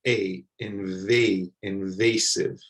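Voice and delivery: "invasive" slow, its A said like the letter name; letter A sound. Shown as a demonstration. Teacher showing learners the A in "invasive", slowly. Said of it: "This sounds like the letter itself".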